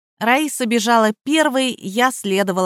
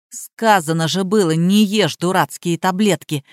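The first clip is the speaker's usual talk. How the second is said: ordinary delivery, nothing marked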